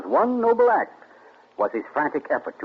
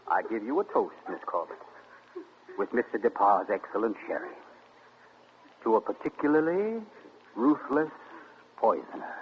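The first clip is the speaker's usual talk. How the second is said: softer, measured, savoured words; ironic toast; 4 semitones lower, 6 dB quieter than usual. Acted. Accuser toasting the nurse he exposed, ironic. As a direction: draw out the toast with deliberate pauses.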